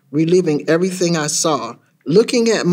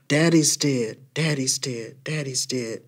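fading, softer with each repeat; haunting echo; 3 semitones lower, 6 dB quieter than usual. A narrator's reading. Grandma's words drowned by news in child's head, echoing. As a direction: let it recede like words ringing in the ear. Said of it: "keeps ringing in my ear".

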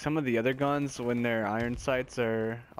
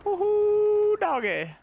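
very high, loud woohoo, then doggy; whooping cheer; 5 dB louder, slower than usual. Real, not acted. Gamer cheering his own kill to viewers, whooping.

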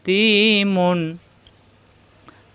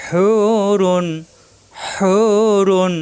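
slow, louder Quranic recitation of Hurun; slow drawn-out recitation; 3 semitones higher, 3 dB louder, much slower than usual. Shown as a demonstration. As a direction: chant Hurun with the vowel stretched. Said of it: "একালিপ টান".

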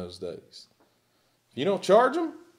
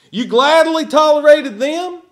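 sarcastic challenge; 11 semitones higher, 10 dB louder than usual. Acted.

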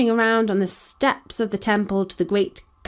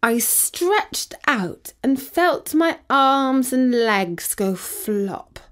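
ordinary delivery, nothing marked

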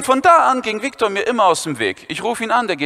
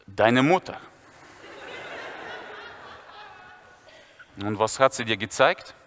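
Viktor's voice; 10 dB quieter than usual. Retold or imitated.

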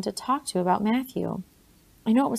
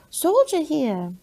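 slow, high call of "Soldier here!"; stretched, high child's cry; child's shout; 7 semitones higher, 4 dB louder, much slower than usual. A narrator's reading. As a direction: call it out like an excited child at the window. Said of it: "one or the other would shout".

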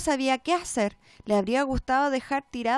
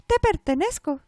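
doll voice; 5 semitones higher, 5 dB louder than usual. A narrator's reading. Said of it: "contestó la muñeca".

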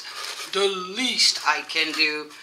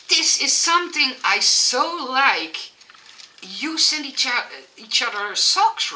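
higher, louder, real excitement; delighted; 5 semitones higher, 4 dB louder than usual. Real, not acted. Vlogger thrilled by socks from a friend, delighted. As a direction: gush with delight, voice lifted and loud.

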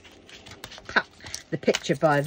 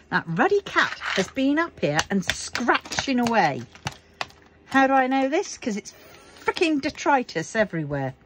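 annoyed; 7 semitones higher than usual. Real, not acted. Annoyed at the cat's mess.

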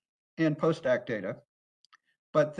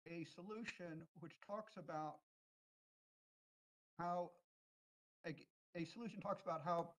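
very faint, far from the mic; speaking from far away on a weak line; barely audible; 19 dB quieter than usual. Real, not acted. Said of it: "Very, very faint".

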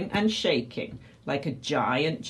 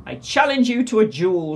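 loud, higher-pitched dare; shouted challenge; 5 semitones higher, 6 dB louder than usual. A narrator's reading. Flynn challenges the Tyrannosaurus to fight, shouting. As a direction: throw the challenge out loudly. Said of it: "shouted Captain Flynn".

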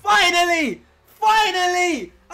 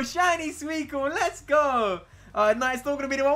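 normal talking voice

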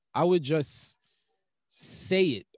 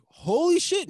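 high, louder burst of mock amazement; sudden realization; 12 semitones higher, 6 dB louder than usual. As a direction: jump up as if an idea just struck. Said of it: "my brain will be like".

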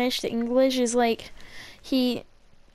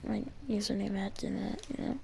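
quick, very soft whispery aside; trailing-off murmur; 3 semitones lower, 13 dB quieter than usual. Real, not acted. Explaining his username mid-game, quick whispery fade. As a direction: let it fade away under your breath.

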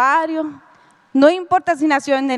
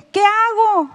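desperate cry; 7 semitones higher, slower than usual. Retold or imitated. Panicked quote during separation crisis.

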